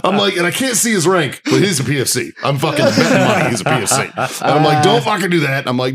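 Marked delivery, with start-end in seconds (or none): 4.8-5.6 exasperated warning to the private; sharp warning. Retold or imitated. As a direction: snap the order at the clumsy soldier. Repeats warning to soldier.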